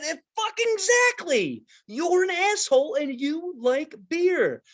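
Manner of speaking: high, slow, emphatic sales pitch; mock excited pitch. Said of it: "I said".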